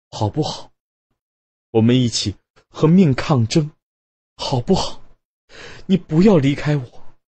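cling to him with each "好不好"; wife begging her husband not to leave, voice climbing; desperate pleading. A narrator's reading. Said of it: "卞太太接着说".

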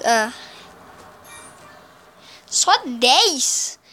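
usual speaking voice

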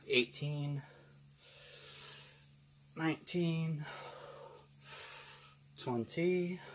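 counting reps while straining; strained counting mid-workout; doing push ups. Real, not acted. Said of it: "I guess freaking out of shape".

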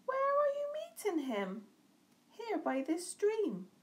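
high questioning owl. A narrator's reading. Owl questioning the mouse, high. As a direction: pitch it up as the owl. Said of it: "An owl saw the mouse".

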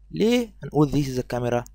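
normal talking voice

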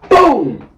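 loud, high boom; boom impact sound. Retold or imitated. Describing the tackle, loud boom. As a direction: slam out a boom. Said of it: "She said".

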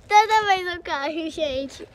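loud crying laugh; half laughing, half crying after a fall; laughing through tears. Real, not acted. Girl laughs and cries after falling.

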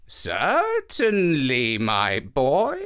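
put-on drawn-out kindly old woman; old woman's voice. Old woman answers boy.

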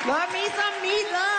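high-pitched shouted cheer; showy shout. Acted. Comic tops off her quote, high showy shout. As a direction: holler it out high like a crowd-pleaser.

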